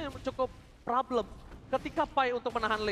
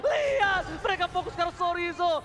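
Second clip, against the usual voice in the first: excited play-by-play; 4 semitones higher than usual. Real, not acted. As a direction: call the fight at full energy. Caster calling a rescue attempt.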